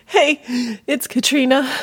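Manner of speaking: normal talking voice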